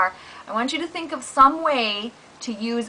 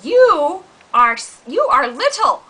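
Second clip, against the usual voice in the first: louder, higher mock taunt as the dog; 5 semitones higher, 6 dB louder than usual. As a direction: tease the cat as a bossy dog. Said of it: "the dog says".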